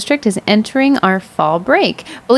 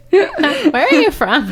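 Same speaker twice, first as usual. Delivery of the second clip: high, slow, laughing where are you from; laughing mock parent question; 8 semitones higher, slower than usual. Parent asking the teacher where she's from, laughing high. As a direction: play a curious parent while cracking up. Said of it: "from a parent going".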